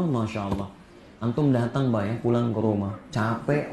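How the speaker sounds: normal talking voice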